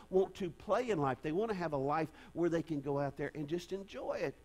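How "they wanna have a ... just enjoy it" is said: ordinary talk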